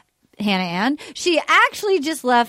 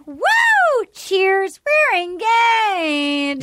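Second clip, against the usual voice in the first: high, drawn-out whoop; hammed-up cheer, stretched way out; mock celebration; 6 semitones higher, much slower than usual. Retold couple cheering their engagement.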